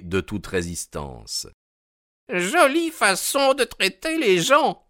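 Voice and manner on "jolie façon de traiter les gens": very high, querulous protest; high-pitched elderly outrage; indignant old man's cry. A narrator's reading. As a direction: cry out like an affronted old sailor. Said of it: "cria-t-il en tapant son bâton".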